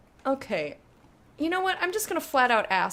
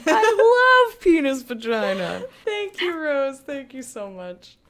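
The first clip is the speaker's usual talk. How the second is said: laughing proclamation; 3 semitones higher, 8 dB louder, slower than usual. Real, not acted. Streamer declaring it to chat, laughing loudly.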